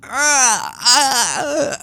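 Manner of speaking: pained gasping cry. Acted. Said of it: "a gasp and, and a scream".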